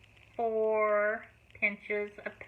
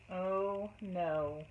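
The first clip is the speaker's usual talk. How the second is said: usual speaking voice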